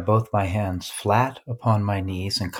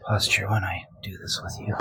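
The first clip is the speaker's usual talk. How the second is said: whispered, almost to yourself; 4 dB quieter than usual. Real, not acted. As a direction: keep it a soft unvoiced whisper. Continues the whispered aside.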